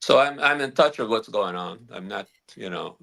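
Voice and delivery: ordinary talk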